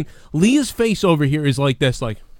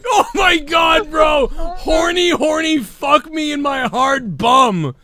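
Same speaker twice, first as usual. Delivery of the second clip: laughing; 9 semitones higher, slower than usual. Real, not acted. Repeats the line, overcome with laughter.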